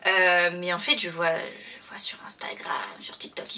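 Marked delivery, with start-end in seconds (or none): 1.8-3.6 whispering. Real, not acted. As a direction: drop to a whisper. Repeats phrase then whispers it. (en chuchotant)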